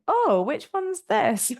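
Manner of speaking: high, slow surprised question. Retold or imitated. Runner surprised to find herself at a checkpoint, high and slow.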